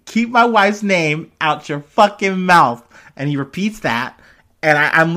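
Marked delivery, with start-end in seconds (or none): 0.0-2.8 louder, slow, higher mock angry yell; Will Smith. Imitating the actor warning the comedian, slow louder mock yell. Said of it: "Will Smith saying".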